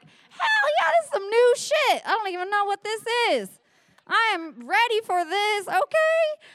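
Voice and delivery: high, loud mock-ecstatic shout; thrilled whooping. Telling audience how thrilled she was, whooping. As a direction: cheer it out at the top of the voice. Acting out her thrilled reaction.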